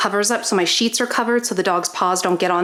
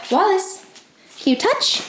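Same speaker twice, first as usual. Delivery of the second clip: dog-directed coaxing; 10 semitones higher than usual. Real, not acted. Owner asking her dog Wallace to touch, high and coaxing.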